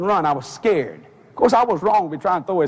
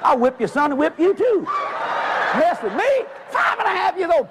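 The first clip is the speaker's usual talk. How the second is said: put-on high-pitched little-boy challenge; cocky boldness; 9 semitones higher than usual. Five-year-old challenging a grown man, cocky. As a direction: taunt him high, like an emboldened child. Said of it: "Boldness came upon me".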